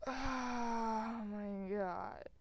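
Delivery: very slow drawn-out dismay; dismayed groan. Real, not acted. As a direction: stretch it out in dismay.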